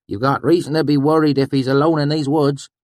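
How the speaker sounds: put-on high, loud old country voice; rustic swineherd. A narrator's reading. Swineherd warning the narrator, high and loud. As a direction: play the swineherd warning a stranger. Swineherd Sheem warns them.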